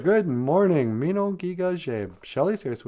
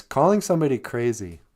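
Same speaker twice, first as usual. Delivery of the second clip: ordinary delivery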